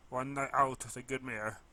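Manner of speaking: usual speaking voice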